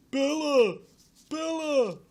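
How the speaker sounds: hammed-up romantic wail; mock lovelorn call. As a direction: cry the name high and let it fall. Wrong Jacob calling for Bella.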